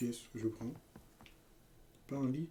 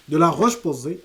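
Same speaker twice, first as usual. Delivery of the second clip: mock emphasis; 4 semitones higher, 17 dB louder than usual. Real, not acted. Joking about rock.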